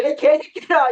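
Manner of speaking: high-pitched mock tirade; angry cursing. Cursing out politicians as an example, angry high-pitched shout. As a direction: hurl the curse in mock outrage. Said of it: "심각하게 욕을 해요".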